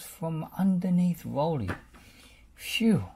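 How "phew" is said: sighed. A narrator's reading. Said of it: "sighed Bob".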